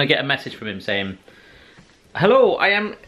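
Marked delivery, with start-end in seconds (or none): 2.1-2.9 high-pitched attempt at an Italian accent; bad Italian accent. Imitating the kitchen delivery guy's message, bad Italian accent. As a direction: put on a poor, high Italian voice. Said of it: "That's not a very good Italian accent".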